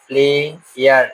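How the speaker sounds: "प्लेयर" broken as प्ले, pause, यर; split into syllables. Shown as a demonstration. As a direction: say "प्लेयर" in two separate beats, प्ले... यर. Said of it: "दो भागों में तोड़ता है". ("प्लेयर" को प्ले और यर में तोड़कर)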